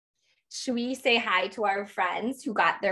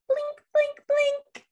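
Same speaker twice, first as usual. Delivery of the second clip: blinking lights; much slower than usual. Pretend. Christmas lights blinking, storytime.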